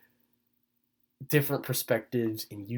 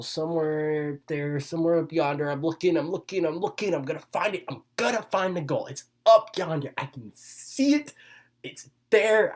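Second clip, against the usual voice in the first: excited searching; 5 semitones higher than usual. Real, not acted. Dreamy searching for the goal.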